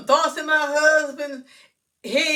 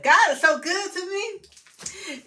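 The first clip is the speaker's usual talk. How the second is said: grateful praise; 8 semitones higher, 3 dB louder, slower than usual. Real, not acted. Praise, thankful for finding eye.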